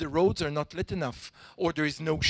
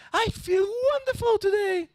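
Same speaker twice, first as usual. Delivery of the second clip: much higher, gushing delight; happy, acted out; 4 dB louder than usual. Shown as a demonstration. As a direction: ham up the joy like a drama student. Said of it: "So I'll just act it out".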